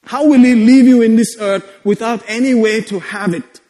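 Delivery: ordinary talk, nothing marked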